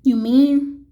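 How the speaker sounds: can't believe his ears; astonished gasp. A narrator's reading. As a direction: Horton amazed at the tiny town. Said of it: "Horton gasped".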